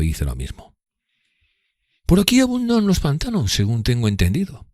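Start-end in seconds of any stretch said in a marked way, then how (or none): 2.0-4.7 high, affected, offhand remark; voicing Drumley's offhand jab. A narrator's reading. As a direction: tease him with it. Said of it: "observó".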